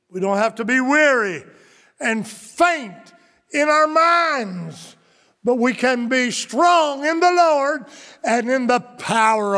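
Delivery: rising preaching. Acted. Preaching rises to full force.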